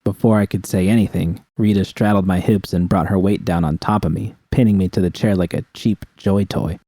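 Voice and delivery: plain reading voice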